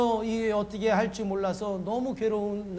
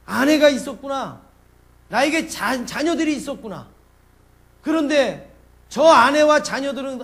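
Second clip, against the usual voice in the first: loud, high self-reproach; passionate, full-voiced outburst at yourself; acted sudden realisation; 6 semitones higher, 11 dB louder than usual. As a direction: cry out as if jolted to your senses. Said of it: "정신이 바짝 나는 거죠".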